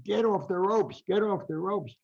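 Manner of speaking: high mock yell from the corner; screaming corner. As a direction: call it like a frantic cornerman. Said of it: "the whole corner is screaming".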